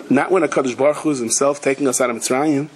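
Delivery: ordinary talk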